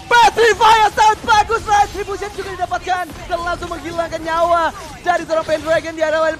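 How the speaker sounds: hyped esports caster yell; excited shouting. Real, not acted. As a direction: shout the big play. Caster hype on big play.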